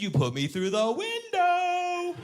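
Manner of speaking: put-on high, slow, drawn-out child's wail; insistent little girl. Daughter insisting to denying dad, high drawn-out protest. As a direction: stretch it into a singsong kid protest.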